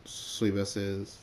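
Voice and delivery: plain reading voice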